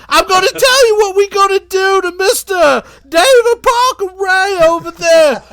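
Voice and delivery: Sick Ripper voice. Retold or imitated. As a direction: squeal it like a giddy maniac.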